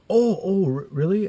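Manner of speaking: loud, high, stammering surprise; startled realization. Retold or imitated. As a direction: play a dad suddenly corrected. Imitates dad realising his mistake.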